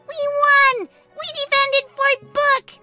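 high, loud, drawn-out victory cry; triumphant cheer. Acted. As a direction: proclaim the win to everyone.

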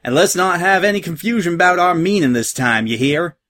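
twangy pirate mare giving orders; Peach twang. A narrator's reading. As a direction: lay down the law with a Southern twang. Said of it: "Dream Valley Peach twang in her voice".

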